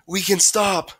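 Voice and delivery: high, loud call ahead; yelled from afar. A narrator's reading. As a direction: call back to the runners behind. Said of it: "Miles yelled from farther up".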